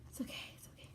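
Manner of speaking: hushed whisper to calm the dog; soothing whisper. Real, not acted. To the puppy after she knocks the fan over, a soft whisper. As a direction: breathe it softly, barely voiced.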